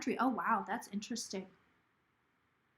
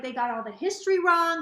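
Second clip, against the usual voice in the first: imitating pissed manager; 5 semitones higher, 6 dB louder than usual. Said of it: "she was pissed".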